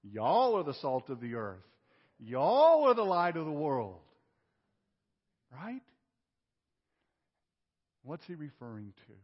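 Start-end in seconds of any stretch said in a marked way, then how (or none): 0.0-4.0 a light Southern drawl on "Y'all"; mock Southern "Y'all", building louder; Southern accent. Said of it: "if he were a Southerner".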